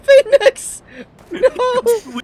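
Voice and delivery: terrified shriek. Real, not acted. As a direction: scream out in real fright. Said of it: "That was fucking terrifying".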